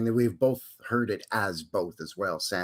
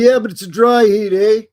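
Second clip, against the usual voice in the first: higher and louder, know-it-all neighbor; smug neighbor voice; 8 semitones higher, 11 dB louder than usual. Retold or imitated. Neighbor brushing off the heat, smug and loud. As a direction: play the smug neighbor correcting you. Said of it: "And he'll say".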